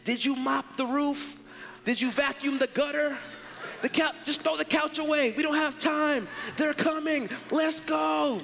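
frantic host. Pretend. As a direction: bark chores in a frantic rush. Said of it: "we go ballistic".

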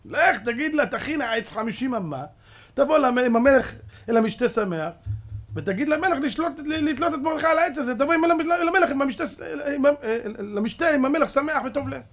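high, fast urging, pushing him on; egging him on with rising excitement; eager scheming advice. Retold or imitated. Advisers egging Haman on, eager and loud.

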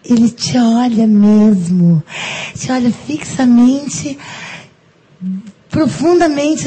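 slow, low, swooning storyteller; slow swooning telling; dramatic slow-down. Acted. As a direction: draw it out like a soap-opera moment. Mock-romantic scene, slowed down.

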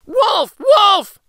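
very loud, high shout of alarm; high-pitched boy's alarm call; crying out in alarm. A narrator's reading. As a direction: scream for the village as the frightened boy. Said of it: "cried the boy".

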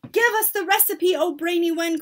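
high, loud clamouring; impatient cry. A narrator's reading. Audience begging for the recipe, impatient cry. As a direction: clamour for it eagerly. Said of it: "cried the audience impatiently".

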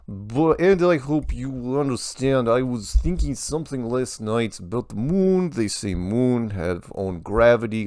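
hammed-up Serbian accent, slowed on the odd phrase; terrible Serbian accent. Reading a Serbian viewer's question about the moon, exaggerated Serbian accent. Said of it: "I distracted myself with my terrible accent".

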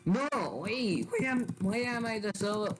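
sudden high whiny "Why am, why am I the server?"; indignant protest. Real, not acted. Unfair role assignment in game.

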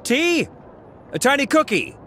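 pleading offers. Acted. Rejected suitor calling after her, high pleading offers. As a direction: keep bargaining in a high voice.

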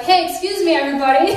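retold calling out.

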